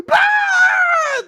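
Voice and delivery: very high, loud comic scream; mock villain scream. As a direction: scream it long and high for laughs. Imitates villain's fleeing scream.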